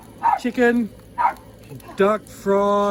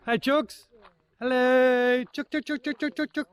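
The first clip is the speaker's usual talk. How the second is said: calling chickens; 8 semitones higher than usual. Real, not acted. Calling his hens in the yard.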